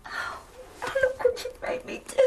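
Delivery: shaky laugh-cry; breathless, distraught sob-laugh; half laughing, half crying. Acted. Distraught after father's fall.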